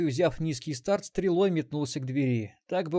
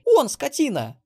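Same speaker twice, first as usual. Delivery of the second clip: loud, high indignant cry; desperate squeal; 13 semitones higher, 9 dB louder, slower than usual. A narrator's reading. Granddaughter denouncing the robber, loud desperate squeal. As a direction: shriek the accusation. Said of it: "вдруг отчаянно заверещала внучка".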